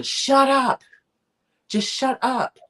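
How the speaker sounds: high, loud barked order to shut up; angry shout. Retold or imitated. Attacker silencing screaming Lady Lucan.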